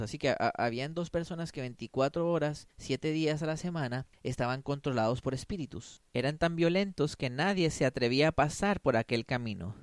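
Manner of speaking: usual speaking voice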